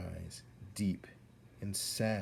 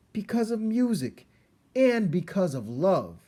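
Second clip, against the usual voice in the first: high, louder earnest plea; raised voice; 12 semitones higher, 8 dB louder than usual. Acted. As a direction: insist on your reasons. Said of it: "He raised his voice".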